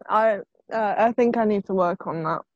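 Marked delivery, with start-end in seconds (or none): none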